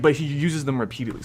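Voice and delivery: usual speaking voice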